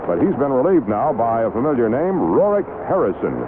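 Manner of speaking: ordinary talk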